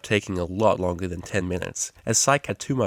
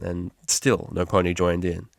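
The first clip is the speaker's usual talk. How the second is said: ordinary delivery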